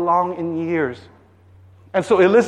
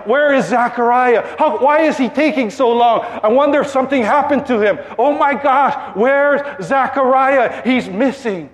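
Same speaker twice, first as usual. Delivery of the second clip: higher-pitched mock fretting; worried crowd; 5 semitones higher than usual. People waiting outside the temple, fretting for Zechariah. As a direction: play an anxious crowd, growing alarmed. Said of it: "the people are outside of the temple and they're waiting".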